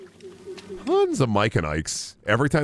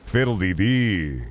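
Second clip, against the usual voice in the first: slow drawn-out catchphrase at usual pitch; catchphrase bit; slower than usual. Acted. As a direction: stretch it and let it fall. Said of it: "And I be going".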